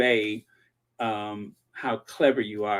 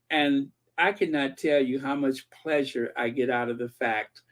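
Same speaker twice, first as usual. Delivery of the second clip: usual speaking voice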